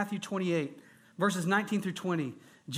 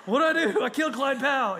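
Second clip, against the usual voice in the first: high, loud mock panic; hammed-up freak-out, pitched up and loud; freaked out guy; 7 semitones higher, 6 dB louder than usual. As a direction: play a panicked diner blurting out in horror. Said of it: "some freaked out guy across from you".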